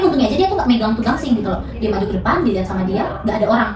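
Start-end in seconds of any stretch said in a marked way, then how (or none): none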